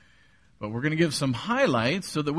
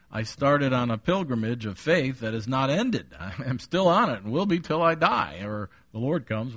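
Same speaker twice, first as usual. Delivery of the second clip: ordinary delivery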